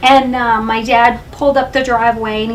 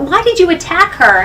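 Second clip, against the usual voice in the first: retold angry accusation; 6 semitones higher, 4 dB louder than usual. Retelling dad's angry accusation.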